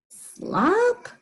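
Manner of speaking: high, rising doubtful question. Acted. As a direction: ask it in disbelief. Gerald doubtful reading.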